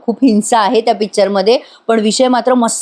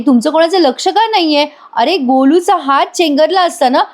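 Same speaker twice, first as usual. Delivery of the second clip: sharp, much higher scolding; scolding yell; 6 semitones higher than usual. A narrator's reading. Asmita yells at Sayali over Golu's finger, angry scold. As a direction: snap at them for not watching the child. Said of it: "अस्मिता ओरडते सायलीवर".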